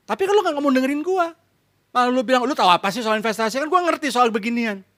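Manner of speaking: a hint of a high, complaining wife; fed-up wife. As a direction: voice her grievance, quick on the quoted husband. (istri mengeluh, suara agak tinggi)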